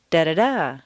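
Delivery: two quick DAs, then a long stressed final "da"; stress on last syllable. Shown as a demonstration. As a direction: punch the third "da". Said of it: "keeping the same intonation pattern".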